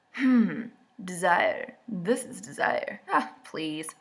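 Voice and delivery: soft, slow, sultry parody; breathy mock seduction. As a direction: purr it like a cheesy romance. Breathy mock reading of romance.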